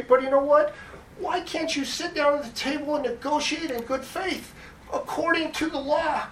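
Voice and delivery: high, strained pleading voice. Real, not acted. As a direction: beg them to negotiate fairly. Pleading emotional appeal to commission.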